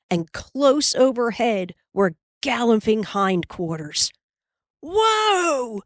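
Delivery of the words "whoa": high, drawn-out yell to stop; long, high stopping cry; yelled command. A narrator's reading. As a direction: holler to halt runaway calves. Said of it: "yelled Almanzo".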